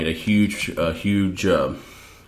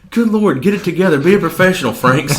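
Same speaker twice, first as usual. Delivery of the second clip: mock scolding; 10 semitones higher, 5 dB louder than usual.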